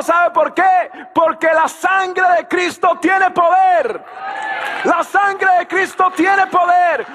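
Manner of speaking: triumphant shouted sermon; preaching at full force. Acted. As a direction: thunder the promise to the congregation.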